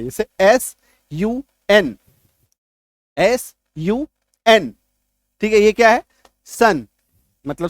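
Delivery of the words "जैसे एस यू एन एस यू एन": एस यू एन spelt out slowly, letter by letter; slow spelling. Shown as a demonstration. Teacher spelling sun for students, slow and deliberate. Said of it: "जैसे ये देखिए".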